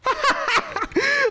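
very high, squealing laugh; embarrassed high-pitched laughter. Real, not acted.

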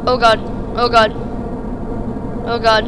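loud worried oh god; rising panic over the rocket; alarmed. Real, not acted. Rocket going wrong, alarmed.

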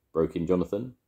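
plain reading voice

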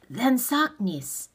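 mildly impatient insistence; insistent. A narrator's reading. Boy presses his demand.

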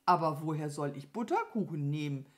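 usual speaking voice

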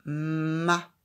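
"ma." repeated loud and distinct; teacher repeating "ma." loudly; repeated syllable. Shown as a demonstration. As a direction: say "ma." again clearly. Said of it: "Je redis".

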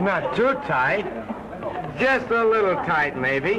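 tipsy and a little unsteady; drunk. Acted. Tipsy man assuring his darling he's only a little drunk. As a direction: play it lightly drunk, denying it. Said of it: "but you're tight".

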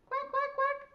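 high-pitched playful duck quacks; duck quacking. Pretend. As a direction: quack like a little duck for children. Voices ducks in counting game.

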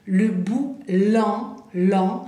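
"Le boulanger" said very slowly, with a strongly nasal AN; teacher model of "boulanger", stretching the nasal vowel; slow French "le boulanger" with a strong nasal vowel. Shown as a demonstration. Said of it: "A, N, bien nasal".

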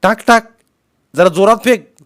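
high, loud commands; shouted urging. A narrator's reading. Uncle telling boy to throw harder, shouting. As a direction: egg him on across the gap.

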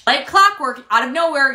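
ordinary talk, nothing marked

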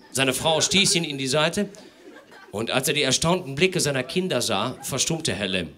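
usual speaking voice

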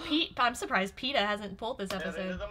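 usual speaking voice

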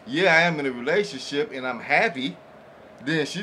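usual speaking voice

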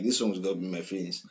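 ordinary talk, nothing marked

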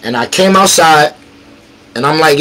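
ordinary delivery

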